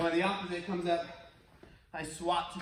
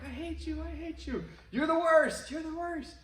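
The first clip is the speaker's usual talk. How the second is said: mock echo; 9 semitones higher than usual. The valley echoes the kid's anger, faint. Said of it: "they're hearing back".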